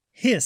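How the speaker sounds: short sharp hiss; goose hiss. A narrator's reading. Reading a picture book, a goose's hiss. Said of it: "said the goose".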